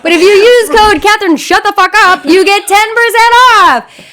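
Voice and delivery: hammed-up sponsor read pushing a promo code; mock ad read. Joking that she sounds like an ad, loud mock pitchman. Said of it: "I feel like I'm doing an ad".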